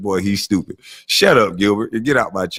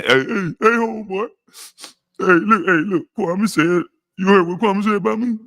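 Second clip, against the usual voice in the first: high, whiny tattletale voice, slowing on Kwame; lampooning a man phoning his boys to complain; mock snitch caller; 8 semitones higher than usual. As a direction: play a cowardly guy running to his friends. Mocks man calling friends to snitch.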